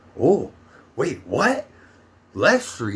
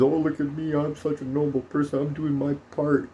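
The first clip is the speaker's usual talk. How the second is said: lightly higher, smug do-gooder voice; mocking vaccinated people; 4 semitones higher than usual. Vlogger mocks the vaccinated crowd, smug mock voice. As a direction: parrot a self-satisfied noble citizen. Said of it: "these fucking zombie herds".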